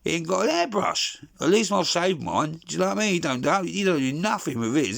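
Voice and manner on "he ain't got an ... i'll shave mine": normal talking voice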